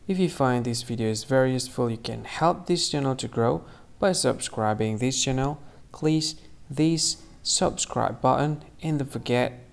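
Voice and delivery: loud, upbeat sign-off read; youtube outro. Real, not acted. Host thanking viewers and asking them to subscribe, loud and upbeat. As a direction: pitch the channel to viewers, full voice.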